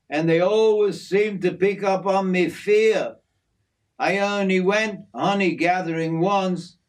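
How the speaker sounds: lightly voiced bushman turning down an idea; Jim, refusing. A narrator's reading. Jim refuses to go honey gathering, firm refusal.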